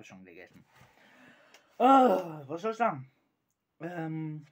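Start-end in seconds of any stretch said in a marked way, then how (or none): 1.8-4.5 loud cry. Real, not acted. Sour candy reaction.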